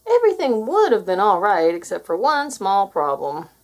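ordinary delivery, nothing marked

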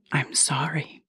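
soft, hushed apology; whispering. Acted. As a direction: breathe it in a whisper. Mermaid apologizes softly.

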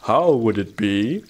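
slow, gently dreamy wondering; dreamy musing. A narrator's reading. Hedgehog musing to himself on the bank, dreamy. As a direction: wonder it slowly, half lost in thought. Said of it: "Iggory sat dreamily".

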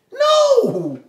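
high, loud "No!"; preacher's loud rejection; emphatic no. Real, not acted. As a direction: cut the idea off sharply. Rejecting a wrong view.

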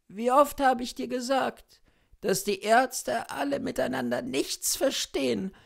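high, loud, pleading sick woman; a feverish invalid pouring out her heart; dying sick woman. A narrator's reading. Sick wife talking to her cousin, high and loud.

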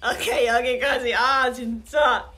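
loud, breaking up with laughter; laughing. Real, not acted. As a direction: call it off while cracking up. Gives up accent bit, laughing.

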